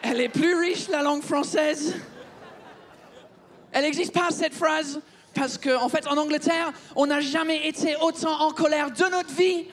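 hammed-up English-accented French; comic Englishman abroad; fake English accent. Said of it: "je vais arrêter de vous parler avec cet accent de merde".

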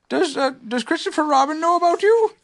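small gentle bear; Pooh voice. A narrator's reading.

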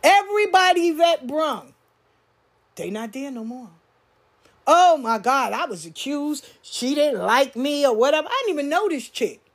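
ordinary talk, nothing marked

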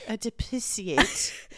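drawn-out parody of posh English; cut-glass English accent. Retold or imitated. Co-host mimicking the fake gentleman, mock cut-glass English accent. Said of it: "it's called a cut glass English accent".

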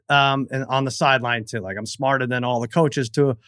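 normal talking voice

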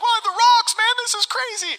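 high-pitched mock amazement; astonished onlooker. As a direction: squeal it as a baffled bystander. Voices onlookers amazed by rocks.

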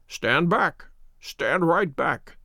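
slow, commanding warning to keep back; a sick man's imperious command, slowed; sharp imperious command. A narrator's reading. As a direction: order him off with sick authority. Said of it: "with the sharp imperiousness".

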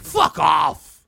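low, very loud shout; hammed-up office swearing; mock outburst. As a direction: bark it like a cursing colleague. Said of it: "They're like".

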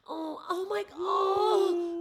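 high, drawn-out mock wail of sympathy; pitying audience women. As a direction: coo "oh" like a mortified onlooker, slow and high. Mimicking pitying women in front row.

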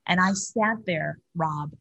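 ordinary talk, nothing marked

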